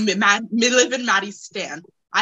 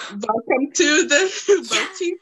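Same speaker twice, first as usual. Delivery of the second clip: high-pitched, giggling through a cartoon greeting; playful quote broken by laughter; laughing recitation; 8 semitones higher than usual. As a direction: recite the show's welcome while cracking up. Quotes show's greeting while cracking up.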